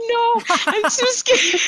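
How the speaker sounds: high, slow, laughing whine of a scared man; mock a grown man too scared to go; laughing imitation of a frightened guard. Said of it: "This is a grown man".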